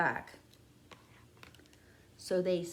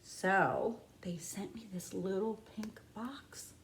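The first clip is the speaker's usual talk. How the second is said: hushed; 4 semitones lower, 7 dB quieter than usual. Pretend. Hushed build before frog.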